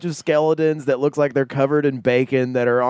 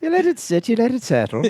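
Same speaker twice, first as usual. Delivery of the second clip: mock waitress; 8 semitones higher, faster than usual. Mimics the Irish waitress.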